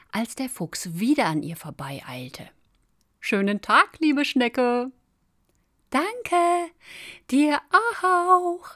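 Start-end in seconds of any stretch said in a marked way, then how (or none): 3.2-4.9 higher, louder passing hello; called greeting. A narrator's reading. Fox hurrying past the snail again, calling a greeting. 5.9-8.7 very high, loud, sing-out call; calling after someone. A narrator's reading. Snail to the fox racing away, high far-carrying call. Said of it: "rief sie hinter ihm her".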